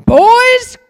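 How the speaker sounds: a sudden high, loud shout of glee; rally the boys like they just won something; excited call. Retold or imitated. Boy calling his friends over, thrilled and loud.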